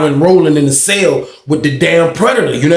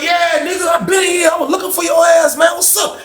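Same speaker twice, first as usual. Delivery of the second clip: hyped shout. Retold or imitated. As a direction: yell it like an old friend spotting you. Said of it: "He was like".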